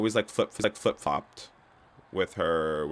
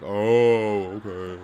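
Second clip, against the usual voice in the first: lightly voiced crowd suddenly getting it; crowd catching on; 2 semitones higher, 7 dB louder, much slower than usual. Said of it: "the crowd's like".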